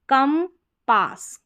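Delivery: Compass slowly as kam, pause, pass; slow, spaced compass for learners; split into syllables. Shown as a demonstration. Teacher splits word, then blends.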